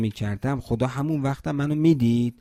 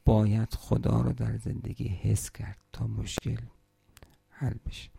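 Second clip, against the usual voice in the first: hushed, unvoiced closing thought; whisper; 5 dB quieter than usual. Real, not acted. Preacher whispers the lesson to mourners, hushed. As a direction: whisper it like a secret to the crowd.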